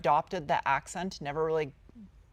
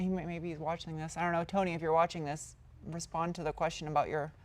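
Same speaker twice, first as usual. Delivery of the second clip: usual speaking voice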